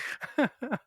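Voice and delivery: high, breathy nervous giggle; uneasy laugh at bad news. Real, not acted. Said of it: "That's a nervous laugh".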